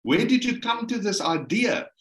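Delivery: high, insistent, incredulous questioning; acted outrage, pitched high; indignant wife. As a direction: imitate a wife flatly refusing to believe him. Wife's outraged reaction, retold.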